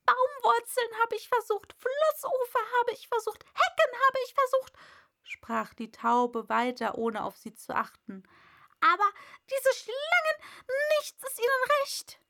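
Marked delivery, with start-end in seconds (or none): 0.1-4.7 high, loud pigeon lament; exasperated bird's complaint; distraught pigeon. A narrator's reading. Distraught pigeon lists its failures. 8.8-12.2 high, loud bird rant; agitated pigeon complaint; exasperated pigeon. A narrator's reading. Pigeon rants about snakes.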